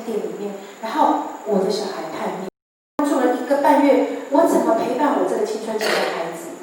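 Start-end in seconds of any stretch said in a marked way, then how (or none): none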